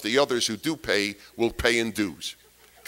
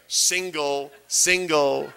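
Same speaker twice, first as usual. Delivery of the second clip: mock matchmakers insisting; much slower than usual. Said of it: "They didn't care".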